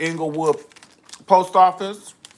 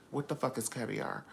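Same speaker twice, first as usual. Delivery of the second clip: ordinary talk, nothing marked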